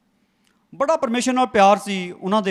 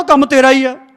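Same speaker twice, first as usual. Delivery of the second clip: louder stern rebuke; scolding rebuke; 4 semitones higher, 8 dB louder, slower than usual. Retold or imitated. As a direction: scold him sharply. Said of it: "ਦਬਕਾ ਮਾਰ ਕੇ".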